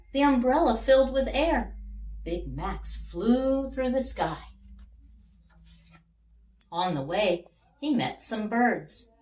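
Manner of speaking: lively, expressive storybook narration; reading to children. A narrator's reading. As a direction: tell it with wonder for young listeners. Reads picture book to children.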